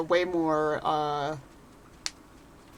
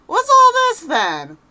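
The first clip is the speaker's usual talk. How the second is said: squeaky high, loud mock bobby; hammed-up guard; 13 semitones higher, 10 dB louder, much slower than usual. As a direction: overblown comic constable. Hammed-up guard line, much higher.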